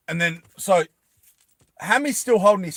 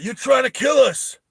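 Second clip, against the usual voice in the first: higher, louder accusation of betrayal; angry accusing shout; 10 semitones higher, 6 dB louder than usual. Acted. Soldier accusing the queen of trying to kill them, shouting. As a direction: yell it like a cornered soldier. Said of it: "Sylvester Stallone's character is like".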